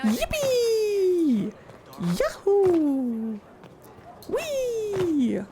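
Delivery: high, drawn-out victory whoops; hammed-up high-pitched celebration; gleeful cheering. Celebrating becoming a Rift Mage.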